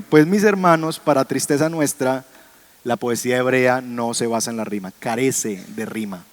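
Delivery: usual speaking voice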